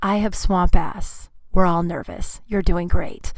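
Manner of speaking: lightly voiced reassuring bride; calm and kind, settle her nerves; a touch of a soothing, smiling whisper-to-friend. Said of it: "She smiles at me and goes".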